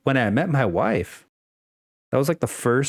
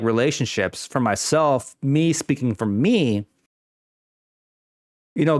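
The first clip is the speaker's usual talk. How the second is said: ordinary talk, nothing marked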